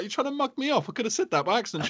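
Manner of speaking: high, fast hammed-up accusing; mock accusation. He accuses his missus of mugging him off, high and quick. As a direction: gabble the accusation in a high put-on voice. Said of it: "I was like".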